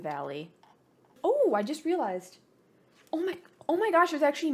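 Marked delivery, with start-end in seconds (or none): 1.2-4.5 much higher, louder surprise; surprised squeal. Real, not acted. Gamer discovering extra back buttons, surprised squeal. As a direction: gasp as you spot something new.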